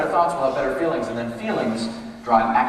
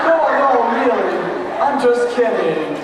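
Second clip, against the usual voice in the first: loud, higher, slow insistence; hurried backpedal; 8 semitones higher, 7 dB louder, slower than usual. Pretend. Insisting to Melissa it was a joke, loud and slow.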